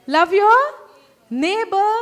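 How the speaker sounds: loud ringing proclamation; loud call. Shown as a demonstration. Preacher leading congregation, loud proclaiming call. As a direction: belt out the commandment for the crowd. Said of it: "say loudly".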